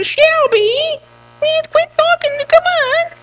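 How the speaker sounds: very high squeaky silly voice. Pretend. Grandpa teasing granddaughter Shelby in a very high silly voice. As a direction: squeak it out like a cartoon. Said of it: "I use silly voices".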